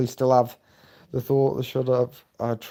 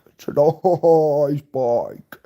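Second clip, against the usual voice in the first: slow drawn-out read; 3 dB louder, much slower than usual. Acted. Reading a viewer's chat message.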